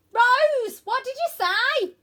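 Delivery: very high, loud shout; boy yells over dragon's wings; shouting over noise. Acted.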